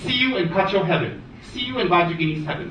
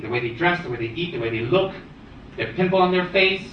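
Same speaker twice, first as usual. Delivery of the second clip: ordinary talk, nothing marked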